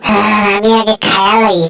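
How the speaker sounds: ordinary talk, nothing marked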